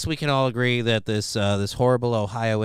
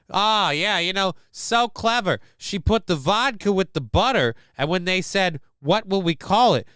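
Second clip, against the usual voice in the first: higher-pitched sarcastic gushing; mock admiration; 6 semitones higher than usual. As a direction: pretend it is terribly clever. Sarcastic praise of the name.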